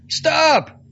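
high, loud shout of alarm. Acted. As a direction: yell to stop the shot. Priest fires pistol at his hand.

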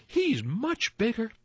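high, tiny pleading voice; tiniest billy goat. A narrator's reading. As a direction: squeak it as the littlest goat. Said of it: "said the tiniest billy goat Gruff".

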